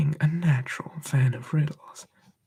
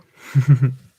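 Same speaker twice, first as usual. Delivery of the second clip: sudden loud burst of laughter; 12 dB louder than usual. Real, not acted. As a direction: break out laughing mid-whisper. Laughs mid ASMR story.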